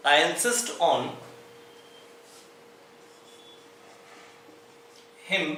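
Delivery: very slow, word by word; slow dictation. Shown as a demonstration. Teacher dictating a sentence to students, very slow. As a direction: spell it out slowly so students can write.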